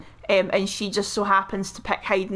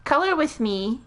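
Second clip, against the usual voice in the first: higher-pitched mock podcast host; true-crime podcaster; 6 semitones higher than usual. Host inviting listeners to colour along, true-crime podcaster voice. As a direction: sing out a podcast invitation lightly. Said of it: "is that your true crime podcaster voice".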